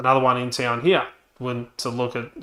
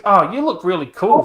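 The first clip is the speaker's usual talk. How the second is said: higher, louder mock flattery; imitated sweet talk; 7 semitones higher, 8 dB louder than usual. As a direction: gush like a flattering salesperson. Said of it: "trying to sweet talk me".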